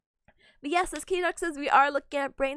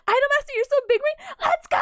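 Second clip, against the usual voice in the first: excited cheer; 10 semitones higher, 7 dB louder than usual. Real, not acted. Hyped cheer for Idol Master.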